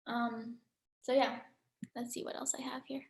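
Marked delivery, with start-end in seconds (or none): 2.0-3.0 unvoiced whisper to herself. Real, not acted. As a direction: whisper it under your breath. Reading notes to herself.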